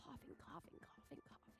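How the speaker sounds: fading to a whisper. Real, not acted. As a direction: let it die to a whisper. Trails into whisper.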